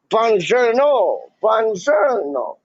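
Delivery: high, slow, drawn-out sing-song Italian buongiorno; mock Italian greeting. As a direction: play up the Italian hello, slowly.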